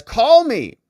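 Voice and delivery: sharp mock call. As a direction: order him to call, loud. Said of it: "She says".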